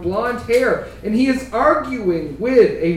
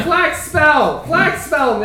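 slow, raised-pitch call; calling a name; 6 semitones higher, much slower than usual. Acted. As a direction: call out for someone across an alley. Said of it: "They're calling the name".

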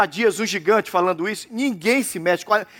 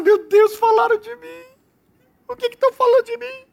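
much higher, fretful whine; mock panic; 12 semitones higher than usual. A worried listener hearing of gossip, mock panicked whine. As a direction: squeak in fake alarm.

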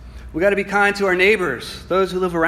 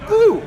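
surprised whoop; 7 dB louder than usual. Real, not acted. Laughing at his own slip.

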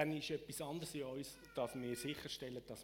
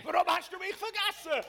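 high, full-force yelled question; anguished shout; 10 dB louder than usual. Acted. Interpreter mirroring the psalmist's complaint to God, shouting.

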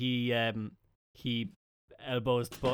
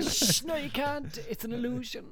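high bluffing voice; 11 semitones higher than usual. Acted. Player bluffs in character.

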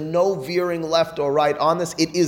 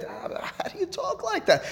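very high, softer astonished question; incredulous protest; 7 dB quieter than usual. Acted. Teacher questioning how Harambam can talk so, incredulous protest. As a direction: send the pitch way up in disbelief. Teacher acts out shocked objection.

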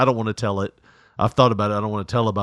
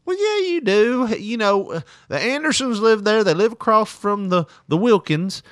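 mom's voice; 9 semitones higher than usual. Retold or imitated. Mom voiced in story.

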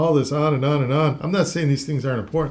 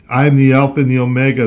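louder, quicker proclaiming voice; 7 dB louder, faster than usual. Retold or imitated. Host voices the heavenly voice in Revelation: loud proclamation. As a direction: ring it out like a voice from behind. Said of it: "I heard behind me a loud voice".

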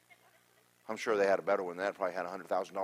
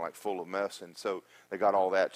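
ordinary talk, nothing marked

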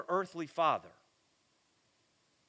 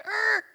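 stunned grunt; 7 dB louder than usual. Retold or imitated. Everything stopped after splash.

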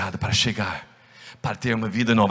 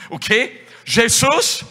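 high, louder amazed questions; light up at the news; excited surprise; 12 semitones higher, 5 dB louder, slower than usual. Acted. Blind Bartimeu hearing that Jesus is here, amazed. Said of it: "Ele fala".

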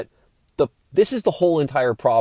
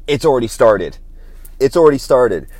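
emphatic exclamation; 8 dB louder than usual. Real, not acted. Wife already anxious about flight.

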